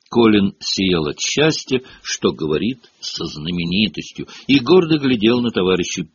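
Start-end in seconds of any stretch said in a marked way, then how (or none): none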